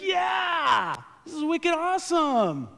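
high, loud thrilled cheer; excited shout. Retold or imitated. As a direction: shout it like a delighted fan. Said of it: "And she's like".

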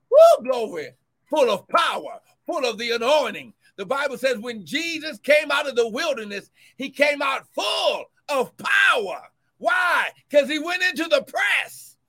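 loud, rising sermon cadence; fervent preacher building; preaching at full force. Acted. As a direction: drive the point home to the church. Preaching climax.

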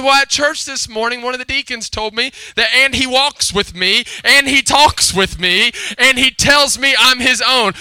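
put-on earnest little girl. Little girl tells Dad what the deacon said, earnestly reciting. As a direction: imitate a child reciting what she was told. Girl explaining with misheard hymn.

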